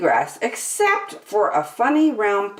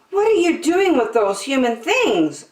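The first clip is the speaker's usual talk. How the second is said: shrieked; 9 semitones higher, 4 dB louder than usual. A narrator's reading. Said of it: "Aquita shrieked".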